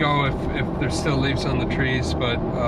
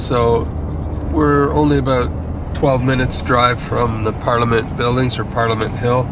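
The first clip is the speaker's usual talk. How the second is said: travel vlog narration; 5 dB louder than usual. Acted.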